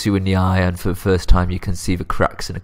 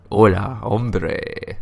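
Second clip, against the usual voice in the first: lightly put-on, clumsy Spanish accent; dodgy mock Spanish accent; bad Spanish accent; much slower than usual. Shown as a demonstration. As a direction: have a half-hearted go at a Spanish accent. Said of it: "I can't even do a Spanish accent".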